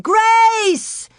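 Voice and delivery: high, loud desperate scream; girl screaming for lost sister. A narrator's reading. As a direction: scream her name across the prairie. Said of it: "she screamed".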